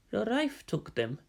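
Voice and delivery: soft, dazed and hollow; numb, quiet. A narrator's reading. Ambassador answering his superior, soft and numb. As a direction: let it fall out numbly. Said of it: "the ambassador added numbly".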